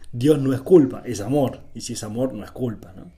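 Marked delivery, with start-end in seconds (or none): none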